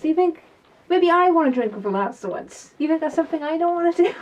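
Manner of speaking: fast, rising irritated outburst; getting heated. Real, not acted. Ranting to camera about Gwyn drinking with the lads, heated and fast. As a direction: let the frustration boil over quickly. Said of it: "I'm getting heated up about this".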